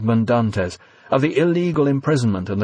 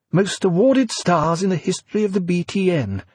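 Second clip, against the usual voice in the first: news read; 9 semitones higher than usual. A narrator's reading. Newsreader continues.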